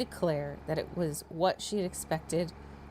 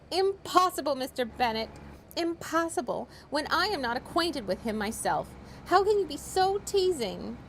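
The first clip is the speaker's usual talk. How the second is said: exasperated mother; 7 semitones higher than usual. A narrator's reading. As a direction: wail it at your husband.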